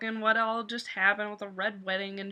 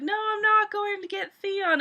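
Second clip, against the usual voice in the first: dismissive father; 10 semitones higher than usual. Retold or imitated. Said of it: "he's like".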